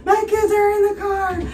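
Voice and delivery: very high, stretched-out plea; high mock scream, words held long; retold scream. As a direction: re-enact screaming for the kids without real volume. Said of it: "I'm literally screaming".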